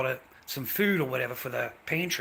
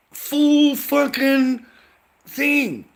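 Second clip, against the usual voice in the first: much higher and louder, dragged out slow; furious shout; 13 semitones higher, 10 dB louder, much slower than usual. Real, not acted. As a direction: blow up, shouting every word. Furious retelling of woman's outburst.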